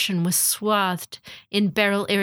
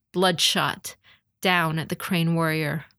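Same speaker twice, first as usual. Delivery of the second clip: plain reading voice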